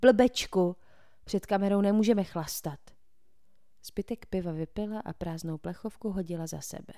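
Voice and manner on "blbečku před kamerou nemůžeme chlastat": scolding. A narrator's reading. Janet scolds the freshman.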